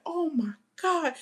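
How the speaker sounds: slow, high mock shock. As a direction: drag it out in disbelief. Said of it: "I was like".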